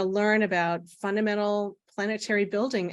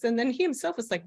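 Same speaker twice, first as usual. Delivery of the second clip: ordinary delivery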